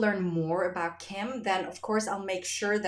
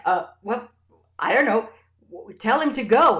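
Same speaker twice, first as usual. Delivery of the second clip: louder flustered reply; flustered husband; 3 semitones higher, 9 dB louder than usual. Retold or imitated. Husband telling daughter to send the man away, flustered and loud. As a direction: blurt it out, rattled and unsure. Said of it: "It was like".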